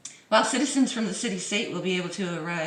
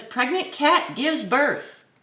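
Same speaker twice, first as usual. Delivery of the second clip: slow louder tabloid read; dramatic headline read; 2 semitones higher, 4 dB louder, slower than usual. Acted. Vlogger reading a clickbait headline, slow dramatic read. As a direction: read it like a teaser.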